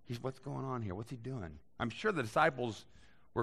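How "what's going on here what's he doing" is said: hushed confused aside. Retold or imitated.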